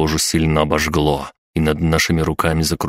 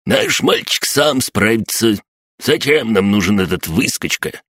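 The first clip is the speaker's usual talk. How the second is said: put-on high-pitched objecting old codger; grumbling old man; 5 semitones higher than usual. A narrator's reading. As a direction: protest like a crotchety old man. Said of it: "вдруг гаркнул согнувшийся старикашка". (сварливый старикашка, высоким голосом)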